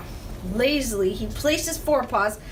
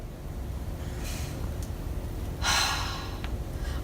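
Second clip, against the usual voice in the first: long, breathy weary sigh; heavy sigh; 8 dB quieter than usual. Acted. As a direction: sigh like it's all too much. Rat grumbling about chores.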